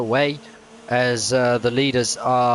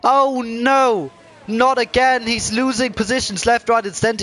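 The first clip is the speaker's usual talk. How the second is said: excited commentary; 9 semitones higher than usual. Real, not acted. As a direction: call the spin in alarm. Driver spins, loses positions.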